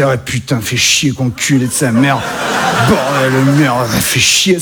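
low, grumbling mock-Parisian; imitated Parisian accent. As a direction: swear like a caricature of a fed-up Parisian. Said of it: "l'accent parisien particulièrement". (accent parisien caricatural, grave et râleur)

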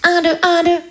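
high count-in into a pretend microphone; singer's mic check. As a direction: count yourself in like a child pop star. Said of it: "J'ai pris une brosse à cheveux et j'ai fait".

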